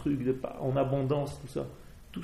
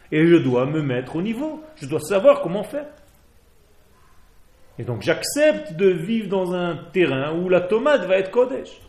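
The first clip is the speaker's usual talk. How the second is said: louder and higher, rising joy; enthusiastic; 6 semitones higher, 8 dB louder than usual. Real, not acted. Rabbi marvelling to students about holy produce, excited. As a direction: marvel aloud at eating holiness.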